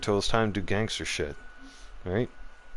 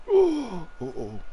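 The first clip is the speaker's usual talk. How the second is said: sharp gasp, then a high uh-oh; gasp of surprise; 10 semitones higher than usual. Real, not acted. Streamer seeing an enemy teleport, gasping high in surprise. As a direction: caught off guard, high and sudden.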